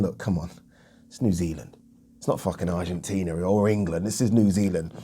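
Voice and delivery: low, soft, dismissive aside; mock confidential persuasion. Brazilian football boss lobbies FIFA over the split, low and dismissive. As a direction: imitate an official quietly talking someone round.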